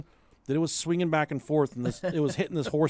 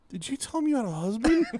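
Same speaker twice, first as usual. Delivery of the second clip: put-on higher child asking; the daughter asking her mom, voiced higher; 8 semitones higher, faster than usual. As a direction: lightly voice the curious daughter. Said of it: "Sophie was like".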